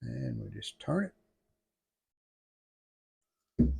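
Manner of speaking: ordinary talk, nothing marked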